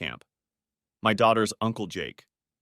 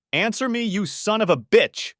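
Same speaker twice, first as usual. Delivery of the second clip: furious yell; 11 semitones higher, 4 dB louder than usual. Acted. Husband yelling at his traitor friend on the phone, furious. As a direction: curse him down the line.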